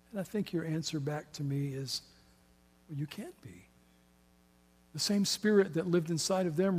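hushed pastoral prayer closing the sermon; quiet prayer. Real, not acted. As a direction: pray softly and earnestly to God. Leads closing prayer softly.

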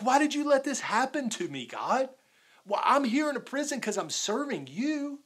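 frustrated, accusing. Acted. Preacher imagines Paul blaming God from prison, frustrated. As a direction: demand an explanation, acting indignant. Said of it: "pointing his finger at God".

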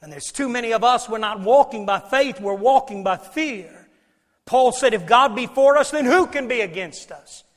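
loud, high-pitched preacher's proclamation; full-force preaching. Real, not acted. Preacher telling congregation God says fear not, full-force preaching. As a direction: hammer each phrase out to the congregation.

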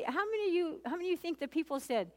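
ordinary talk

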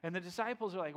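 ordinary talk, nothing marked

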